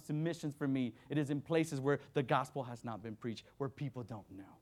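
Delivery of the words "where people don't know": usual speaking voice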